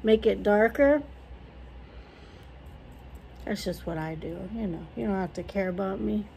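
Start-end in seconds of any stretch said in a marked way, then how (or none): 3.4-6.3 mumbled aside. Real, not acted. Self-deprecating low aside.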